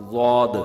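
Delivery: ضاد with the tongue edge against the molars; ضاد held like a heavy ghain-like sound; correct zwad sound. Shown as a demonstration. Teacher showing students the proper ضاد sound. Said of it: "زبان کا کنارہ ... داڑھ کے کنارے سے touch".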